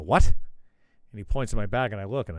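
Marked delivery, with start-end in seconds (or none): 0.0-0.3 startled, loud "What?" rising; a sudden loud puzzled "What?"; surprised what. Retold or imitated. Hungover guest startled by the bartender, loud "What?". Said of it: "And I go".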